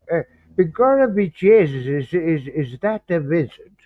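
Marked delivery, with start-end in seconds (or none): 0.6-1.9 second, bolder go at a hammed-up stage Irish accent, higher and louder; overplayed Irish brogue, pitched up. Shown as a demonstration. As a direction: commit harder to the mock Irish brogue this time. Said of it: "I'm trying to get the accent".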